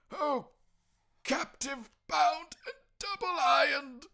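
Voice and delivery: high, loud, slow anguished cry; the phantom wailing its regret. Acted. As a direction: cry out in chained despair. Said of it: "cried the phantom".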